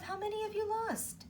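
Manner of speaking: plain reading voice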